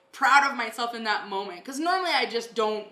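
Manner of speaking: usual speaking voice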